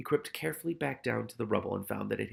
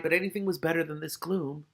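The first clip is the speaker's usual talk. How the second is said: ordinary delivery, nothing marked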